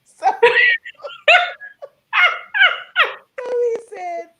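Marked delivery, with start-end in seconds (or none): 0.3-1.9 high, loud laugh of delight; burst of laughter. Real, not acted. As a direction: laugh out hard at the memory. Bursts laughing at own story.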